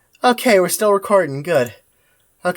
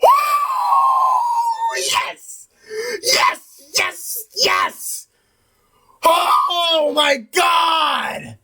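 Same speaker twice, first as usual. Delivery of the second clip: real whoops and shouts of joy, pitch leaping high; cheering at the screen after a hard win; ecstatic yelling; much slower than usual. Real, not acted. Gamer cheering at the screen after a win, ecstatic shouts.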